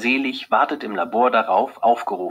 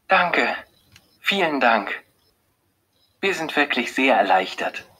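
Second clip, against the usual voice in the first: glad, relieved thanks; 5 semitones higher than usual. A narrator's reading. Wife thanking the doctor for good news, gladly relieved. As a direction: let the relief bubble up a little. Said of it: "sagt Beate erfreut".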